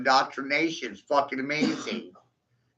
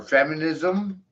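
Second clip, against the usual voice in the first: ordinary delivery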